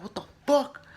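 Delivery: high, loud startled yelp; retold cry of disbelief; mock shock. Acts Ethan's shock as he ducks.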